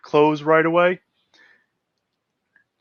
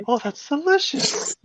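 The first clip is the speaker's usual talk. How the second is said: put-on polite girl's voice; 11 semitones higher, 5 dB quieter than usual. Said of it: "nods and it's like".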